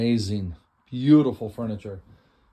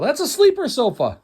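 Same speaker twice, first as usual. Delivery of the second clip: excited; 14 semitones higher, 7 dB louder than usual. Real, not acted. Mock-dramatic announcement.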